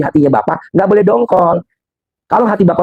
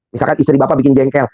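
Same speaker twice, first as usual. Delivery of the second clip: ordinary delivery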